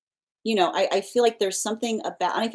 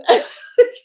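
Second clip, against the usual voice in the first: high, bubbling laugh; 7 dB louder than usual. Real, not acted. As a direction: cackle at the picture. Laughing at her own impression.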